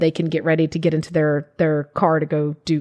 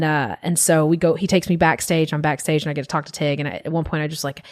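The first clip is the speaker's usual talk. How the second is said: normal talking voice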